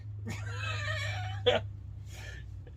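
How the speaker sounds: squealing high-pitched laugh; real high squeal of laughter. Real, not acted. Laughing at doctor's reaction.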